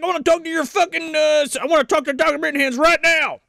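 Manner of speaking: high, fast put-on colonel voice; pushy officer demanding the doctor, high and fast; mock colonel. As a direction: rattle off a demand in a hammed-up colonel voice. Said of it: "He's like".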